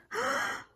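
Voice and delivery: sharp high gasp; dramatic gasp. Acted. Teacher gasping before revealing the fox.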